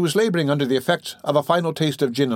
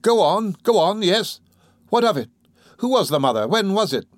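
higher-pitched, urgent questioning; eager prodding; 8 semitones higher than usual. Acted. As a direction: press for more, question after question. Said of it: "bending eagerly over the woman".

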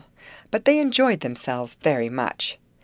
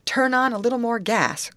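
high-pitched call to the helm; raised, urgent call; crying out; 8 semitones higher, 3 dB louder than usual. A narrator's reading. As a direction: cry out an instruction, pitched up high. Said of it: "cried the steersman to Betty".

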